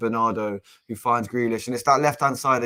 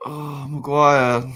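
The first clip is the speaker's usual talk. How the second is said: slow, drawn-out, louder despair at the player; exasperated groan; 4 semitones higher, 5 dB louder, much slower than usual. Real, not acted. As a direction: stretch the name in disbelief. Fan despairs at defender's error.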